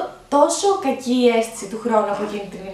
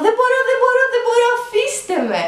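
high, loud pained cry, slowing at the end; retold scream; 12 semitones higher, 4 dB louder than usual. Mother in labour crying out to staff turning her. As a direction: cry out that you can't take it. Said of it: "Και τότε άρχισα να φωνάξω".

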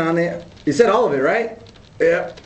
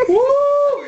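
laughing through the burn, high woo; squealed woo from the heat; high pained woo. Real, not acted. Pained laugh after chili.

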